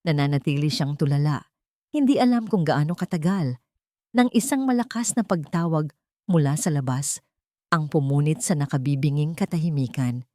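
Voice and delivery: plain reading voice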